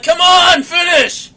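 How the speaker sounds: hammed-up impatient cry; exasperated plea. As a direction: call out in comic frustration. Retold frustrated plea to her.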